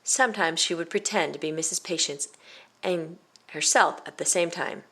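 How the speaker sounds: plain reading voice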